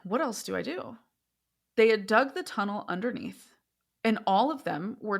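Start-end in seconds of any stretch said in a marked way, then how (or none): 0.0-1.0 straight-faced woman. Retold or imitated. Woman explaining arson to her assessor, straight-faced. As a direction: imitate her matter-of-fact pleading, lifting the pitch. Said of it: "the tone of her voice".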